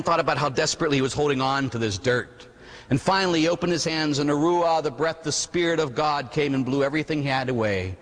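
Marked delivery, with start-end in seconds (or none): none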